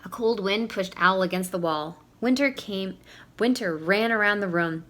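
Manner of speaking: usual speaking voice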